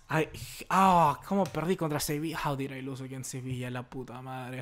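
soft, dejected disbelief; quiet defeated lament. Real, not acted. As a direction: mourn the loss quietly. Dejected after loss.

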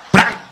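loud high impact sound; loud onomatopoeic bang; crash noise. Retold or imitated. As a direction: punch out the crash.

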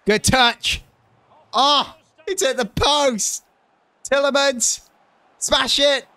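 excited cries. Real, not acted. Gamer watching shots hit the post and get blocked, very high excited cries. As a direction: urge the shot in.

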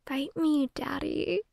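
soft, teasing invitation; flirty come-on. Acted. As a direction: purr it softly, as a practiced seductive line. Trying out a flirty line.

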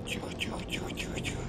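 hushed whisper. Real, not acted. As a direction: whisper to stay unheard. Sneaking past enemy.

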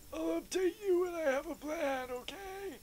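mock him in a squeaky voice; high mocking voice. Mimicking game character higher.

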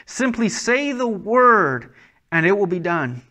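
acted centurion calling out to Christ. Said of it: "The centurion calls out to Christ".

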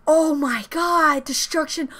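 usual speaking voice

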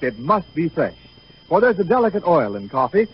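ordinary delivery

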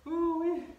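high loud ooh-wee of relief; relieved whoop. Real, not acted. Relief after exercise.

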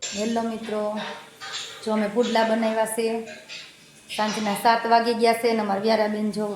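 gentle cooking-show narration; vlog presenter voice. Acted. Mother greeting viewers in a soft vlog voice.

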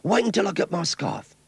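put-on high, keen young boy. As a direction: talk like an excited kid. Skeets talks about the match.

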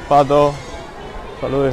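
ordinary talk

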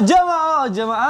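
high sing-song call to the audience. Real, not acted. Host greeting the congregation at home, high sing-song call.

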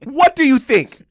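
high, loud, slow angry shout; furious retold shout; retold yelling. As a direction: yell it at dad. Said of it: "I went".

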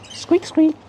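very high squeaky pet voice; high falsetto coaxing; coaxing a bird. Real, not acted.

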